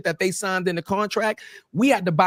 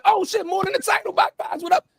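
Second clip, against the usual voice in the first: high, delighted greeting to friends; excited greeting; 14 semitones higher than usual. Retold or imitated. Headliner stopping to greet the crew, high and delighted. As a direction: greet them with big surprise. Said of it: "was like".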